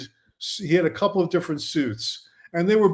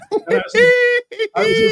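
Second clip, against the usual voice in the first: high laughing burst, much higher and louder; 5 dB louder than usual. Real, not acted. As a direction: break into laughter mid-sentence. Laughing at Depp excuse.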